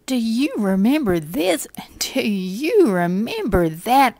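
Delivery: high, loud mocking imp voice; taunting imp. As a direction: gloat over the list like a tormenting imp. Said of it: "And he will say".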